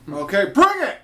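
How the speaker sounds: high, loud mock shout; referee yell. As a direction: imitate a ref yelling to start the match. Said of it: "the ref yells".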